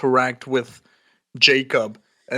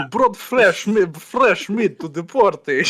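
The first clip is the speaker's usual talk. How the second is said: put-on menacing Russian accent, pitched up; 6 semitones higher, 3 dB louder than usual. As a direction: hiss the line in a Russian accent. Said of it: "a Russian accent there?".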